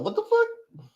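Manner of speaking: sudden high-pitched squeak of shock; startled squeal at a name; surprised. Real, not acted. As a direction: jump up in pitch in disbelief. Surprised to see Rob Jean.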